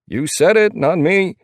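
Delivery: high, loud protest; defensive. A narrator's reading. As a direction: throw it back in self-defense. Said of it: "one says in defense".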